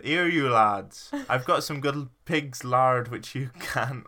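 slow, loud call in a botched Irish accent; hammed-up West Country Irish; Irish accent turned Somerset. Shown as a demonstration. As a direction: hail them in Irish that slips into Somerset. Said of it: "doing his Somerset again".

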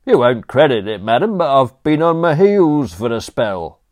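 high, loud cockney manservant offering; chirpy servant barging in with condiments; cheeky butler. Acted. Butler Crawshaw to Aunt Agatha, loud chirpy cockney offer.

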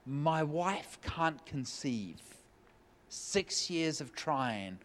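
grieving husband quietly confessing; sad confession. Retold or imitated. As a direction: let the words drag with sorrow. Waiter confides infertility.

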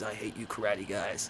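low, quiet tough-guy line; deadpan growl of the quote; low gruff movie quote. Retold or imitated. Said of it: "and he goes".

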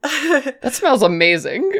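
high, slow admiring voice; Cassie. Acted. Newcomer admires Lou's sandwich, slow and high. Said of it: "and she's like".

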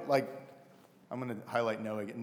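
ordinary delivery

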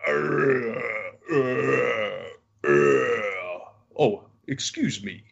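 a light take on a wheezing gentleman; breathy monster apology. Retold or imitated. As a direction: gasp between words, then excuse yourself. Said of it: "he has severe breathing problems".

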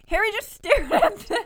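high, loud, breaking into laughter; laughing while reading. Real, not acted. Reader losing it at Harry's stare, laughing loudly. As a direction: crack up mid-sentence.

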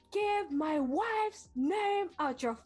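slow, high mock threat; imitated angry yell. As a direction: drag each word out as a warning. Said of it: "todavía más fuerte y más así". (grito imitado, lento y agudo)

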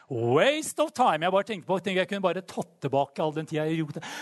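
ordinary delivery

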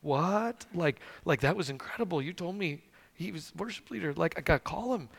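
hushed, disbelieving plea; retold baffled protest. Pleading with God to let the call happen, softly baffled.

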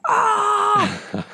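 high, loud triumphant scream; excited scream. Retold or imitated. Imagining her own reaction to the gamer's win, a loud triumphant shriek. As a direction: shriek with joy as if you just won. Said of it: "I'd be like".

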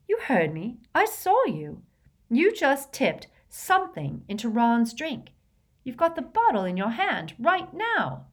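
high-pitched, insistent accusing; outraged confrontation; indignant accusation. Acted. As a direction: press the charge, pitch leaping up. Hermione accuses Harry in quarrel.

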